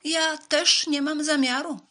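high, loud, drawn-out mouse; loud stretched-out mouse refusal; called-out refusal. A narrator's reading. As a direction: call it out high and slow as the mouse. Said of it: "zawołała szara mysz".

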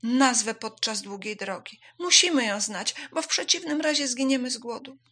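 high, loud swallow plea; chirping, losing breath. A narrator's reading. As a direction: chirp urgently for help. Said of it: "zaćwierkała, tracąc oddech".

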